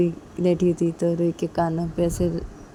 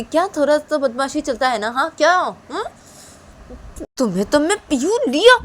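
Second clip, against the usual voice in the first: much higher, louder, sneering repeat of थोड़ा तो बदमाशी चलता है ना; angry mocking echo; 9 semitones higher, 7 dB louder than usual. Mother mocks husband's excuse, angry. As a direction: throw his words back at him.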